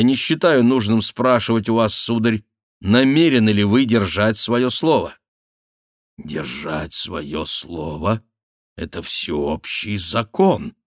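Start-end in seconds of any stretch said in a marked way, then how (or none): 6.2-10.7 put-on slow, measured old baron; solemn pronouncement. Old baron lectures Bussy on keeping one's word, solemn and slow. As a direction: state it slowly and solemnly.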